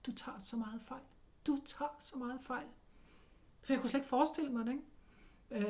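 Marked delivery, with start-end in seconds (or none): 0.1-1.0 softly, to herself; quiet inner thought. Retold or imitated. Silent thought about the clairvoyant. 1.5-2.7 softly, to herself; quiet inner thought. Retold or imitated. Silent thought about the clairvoyant.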